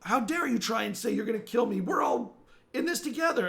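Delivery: high, loud reproach; indignant villager. Acted. Villager scolding the stranger who threatened killing, high and indignant. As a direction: throw the accusation up high and loud.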